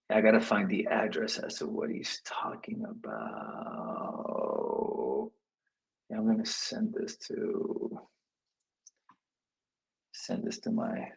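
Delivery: real low, trailing mutter; muttering to self. Real, not acted. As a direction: murmur to yourself while busy with a task. Muttering to himself while looking up address.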